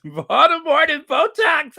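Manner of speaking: high, loud gleeful repeat; delighted exclamation. Real, not acted. Host relishing a viewer's nicknames, high and delighted. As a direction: cry it out with delight.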